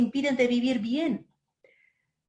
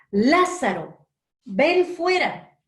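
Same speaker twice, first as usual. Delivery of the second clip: louder, higher, slow commanding call; loud cry; 4 semitones higher, 5 dB louder, slower than usual. A narrator's reading. Jesus calling dead Lazarus out of the tomb, loud slow command. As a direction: call him out of the tomb with full voice. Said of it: "Jesús clamó en alta voz".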